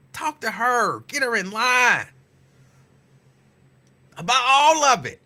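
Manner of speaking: emphatic push. Real, not acted. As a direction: lean hard on it. Pressing the point.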